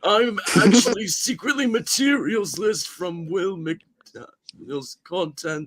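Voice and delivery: loud, high mock shouting intro; hammed-up yelling greeting; silly over-the-top voice. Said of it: "he can't go like".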